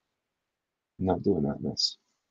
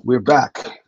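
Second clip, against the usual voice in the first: announcer voice; 3 semitones higher, 10 dB louder than usual. Acted. Announcing return to stream.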